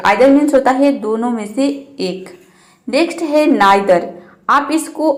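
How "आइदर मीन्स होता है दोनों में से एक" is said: ordinary talk, nothing marked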